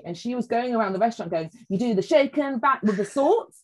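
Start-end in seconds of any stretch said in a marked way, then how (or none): 1.7-3.5 higher, playful sing-out; cheeky sister. Retold or imitated. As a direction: imitate a cheeky big sister's chant. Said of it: "she's really cheeky".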